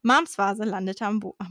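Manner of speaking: plain reading voice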